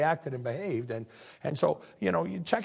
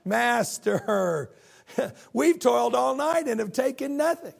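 drawn-out title, then higher-pitched protest; condescending; 5 semitones higher, 3 dB louder, slower than usual. Acted. Peter answering Jesus after a fruitless night, condescending. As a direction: humour him with a patronising chuckle. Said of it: "That's how he said it to him".

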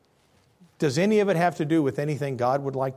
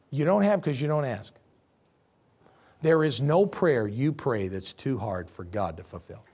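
normal talking voice

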